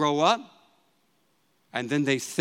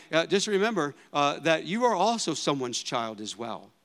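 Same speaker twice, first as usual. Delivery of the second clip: usual speaking voice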